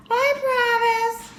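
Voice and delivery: put-on high, sweet little-girl promise; tiny girl's high voice. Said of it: "said Goldilocks".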